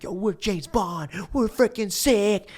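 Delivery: high, drawn-out excited boast; hyped-up bragging. Retold or imitated. Friends boasting as they sneak into the lagoon, hyped-up bragging. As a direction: imitate cocky, thrilled buddies.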